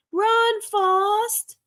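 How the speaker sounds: high squeaky imitation of Jenny; Jenny impression. Imitating Jenny's high voice to the viewers. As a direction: pitch way up to mimic a friend. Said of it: "That's my Jenny impression".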